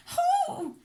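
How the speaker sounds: very high gasp at the cold water. A narrator's reading. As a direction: gasp as she hits the sea. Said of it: "she gasped".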